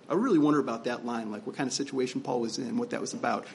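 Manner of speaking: ordinary talk, nothing marked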